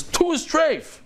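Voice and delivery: annoyed, high-pitched retort; annoyed answer. Acted. Rabbi answering a question he finds obvious, annoyed. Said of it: "If he's annoyed at me".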